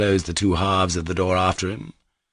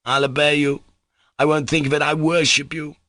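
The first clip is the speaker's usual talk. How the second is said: ordinary delivery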